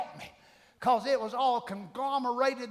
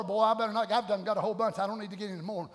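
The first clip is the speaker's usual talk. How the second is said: ordinary talk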